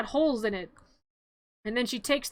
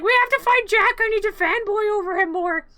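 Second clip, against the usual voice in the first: high, loud gushing fanboy mayor; 9 semitones higher, 8 dB louder than usual. Retold or imitated. Mayor gushing over Jack to townsfolk, high excited fanboy squeal. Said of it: "And he's like".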